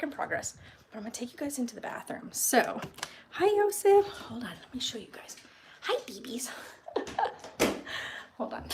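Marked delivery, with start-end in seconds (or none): 3.3-4.0 high, bright pet greeting; cooing at the cat. Real, not acted. Greeting another cat in a high coo. 4.1-5.4 soft whispered aside. Real, not acted. Whispering to viewers while handling the cats.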